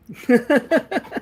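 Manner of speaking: high nervous cackle; unhinged giggle; psychotic laugh. Real, not acted. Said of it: "Psychotic laugh".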